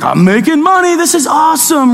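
a high, excited imitation of the gloating friend; lightly hammed-up excitement; gleeful friend. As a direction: crow over tiny stock gains, mock glee. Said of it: "be like".

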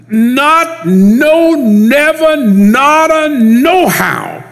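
high, loud, hammering each no; emphatic negation. Real, not acted. Preacher pounding the strongest Greek negation, high and loud. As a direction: pile up every no you can. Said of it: "it's emphatic".

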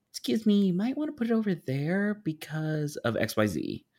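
high, careful excuse-me voice; polite suggestion. Retold or imitated. Stepping in to advise an inexperienced planner, polite and high.

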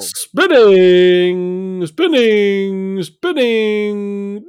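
drawn-out spinning. Acted. Agent spins prize wheel.

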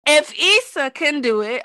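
loud, rising yell. Real, not acted. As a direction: shout it out insistently. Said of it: "I'm sorry to yell in your ears".

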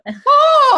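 a sudden high burst of laughter; loud shrieking laugh. Real, not acted. Cackling at the wicked line, high loud shriek.

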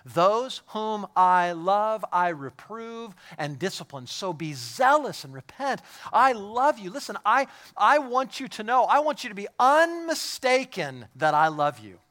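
high, loud, impassioned sermon delivery; full-force preaching. Acted. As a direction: preach it out loud with heavy emphasis.

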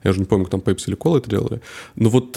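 ordinary talk

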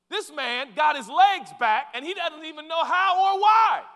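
loud high peak; preaching shout. Real, not acted. Preacher to congregation, shouting the climax. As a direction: hit it full force from the pulpit.

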